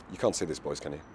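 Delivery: ordinary talk, nothing marked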